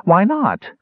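high, indignant little voice; small dwarf character. Acted. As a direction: challenge him as a pesky small creature.

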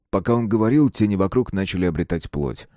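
ordinary delivery, nothing marked

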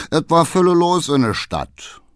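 high, lively Berlin dialect; cheery cabman with Berlin accent; Berlin coachman. A narrator's reading.

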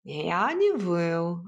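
slow, drawn-out indignant denial; snappish cat. A narrator's reading. Cat denies the hut's taunt, snappish and drawn out. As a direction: snap back, stretching the words. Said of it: "огрызнулся кот".

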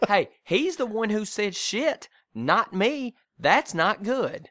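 hammed-up pointing the finger at dad; mock indignant mom. Wife deflects blame onto her husband in a mock indignant voice.

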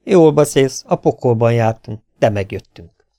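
plain reading voice

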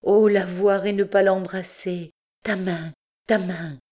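anguished, urgent maternal appeal; desperate cry. A narrator's reading. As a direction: cry out, longing to embrace her. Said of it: "cria la recluse".

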